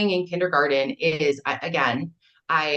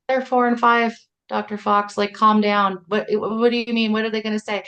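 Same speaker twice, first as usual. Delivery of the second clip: exasperated retort; 7 semitones higher, 4 dB louder than usual. Retold or imitated. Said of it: "And I was like".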